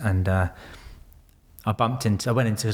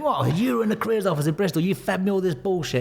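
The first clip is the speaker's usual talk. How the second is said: much higher, fast, startled recruiter; caught-out surprise; 11 semitones higher than usual. Retold or imitated. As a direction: play the recruiter caught off guard.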